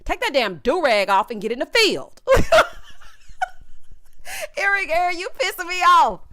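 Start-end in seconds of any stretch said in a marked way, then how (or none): 2.3-4.5 high, airy silent laugh; breathy laugh. Real, not acted. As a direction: wheeze with laughter. Host laughs at her own joke.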